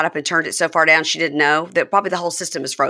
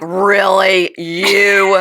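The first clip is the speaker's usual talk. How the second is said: mocking; 3 dB louder, much slower than usual. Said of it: "that is how she said it to him".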